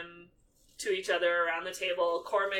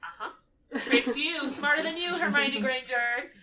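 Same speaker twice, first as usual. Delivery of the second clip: higher, gloating taunt; smug mock Ron; 7 semitones higher, 4 dB louder than usual. Ron gloating at Hermione, higher and smug. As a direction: rub it in with a grin. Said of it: "he's like".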